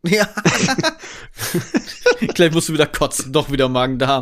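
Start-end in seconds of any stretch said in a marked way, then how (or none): 0.3-2.3 laughing high at his friend; real amused laughter; high teasing laugh. Real, not acted. Teasing partner about crying.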